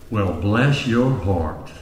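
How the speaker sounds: slow, low, sweet Southern drawl; mock-gentle Southern reply to a bragging woman; gentle Southern lady. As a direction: ease it out slowly like a polite Southern lady. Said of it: "gentle, soft-spoken woman from the South".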